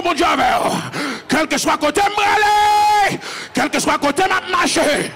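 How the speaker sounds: loud, high, driving sermon chant; shouted full-force preaching; fiery preaching. Acted. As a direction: whip the congregation up, repeating the refrain.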